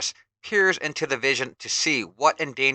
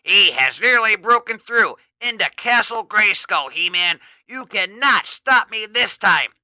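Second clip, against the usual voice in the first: higher, louder gloating taunt; gleeful villain; 8 semitones higher, 9 dB louder than usual. Acted. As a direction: gloat over the hero. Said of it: "exclaims gleefully".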